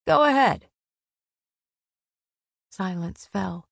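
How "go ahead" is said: loud, higher-pitched challenge; forceful, furious goading; angry dare. A narrator's reading. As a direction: fire it out as a dare. Heated challenge in narrated quarrel.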